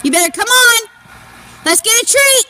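ordinary delivery

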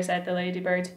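ordinary delivery, nothing marked

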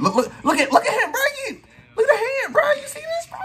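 high, laughing half-shout at the replay; laughing outrage. Real, not acted. Pointing out a foul in his game footage, laughing high outrage. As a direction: cry out in amused disbelief.

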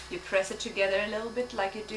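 ordinary delivery

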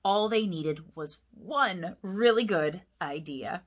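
plain reading voice

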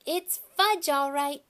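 put-on high, loud toddler voice; little brother parroting. A narrator's reading. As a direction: parrot it like an excited little kid. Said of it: "my brother repeated".

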